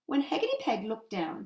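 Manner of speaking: ordinary delivery, nothing marked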